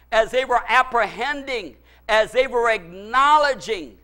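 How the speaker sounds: ordinary talk, nothing marked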